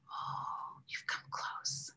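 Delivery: soft intimate whisper, slow; tender whispered invocation. Shown as a demonstration. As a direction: breathe it close to the ear. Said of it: "We're saying".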